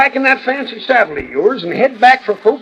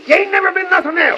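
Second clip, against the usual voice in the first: scornful shout; 8 semitones higher, faster than usual. Acted. Hurling an insult at departing rival.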